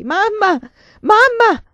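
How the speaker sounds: very high, very loud anguished cry for mother; heartrending scream. A narrator's reading. Schoolgirl told her mother died screams for her, anguished shout. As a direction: scream it out as if tearing the walls. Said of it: "un'invocazione straziante".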